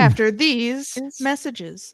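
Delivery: slow announcer read; radio announcer. Pretend. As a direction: deliver it like a radio host. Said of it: "Say it in your radio voice".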